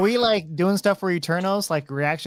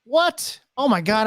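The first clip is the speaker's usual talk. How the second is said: high, sudden shock; a sharp gasp of disbelief; 6 semitones higher, 3 dB louder than usual. Real, not acted. Reads spoilers online in shock.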